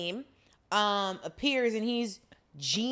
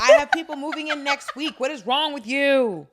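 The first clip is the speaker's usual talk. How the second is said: loud, raised-pitch indignation; mock outraged landlord; 5 semitones higher, 7 dB louder than usual. Landlord scolds brother for wrecking the building, outraged. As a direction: act out an exasperated outburst, loud and higher.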